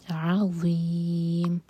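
عظیم very slow, ع from the throat, م stopped; throat ayn. Shown as a demonstration. Teacher draws out عظیم to model the ayn. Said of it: "عین حلق سے".

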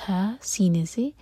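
ordinary talk

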